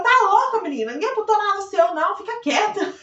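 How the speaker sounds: high, loud scolding; sharp retort shout. Retold or imitated. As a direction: snap back like a sassy nurse. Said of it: "Ela gritou". (bronca alta e atrevida)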